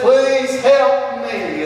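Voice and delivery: high, slow, pleading plea; pleading woman. Acted. Preacher acting a starving mother begging the king, slow. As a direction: stretch out each word as a begging mother would.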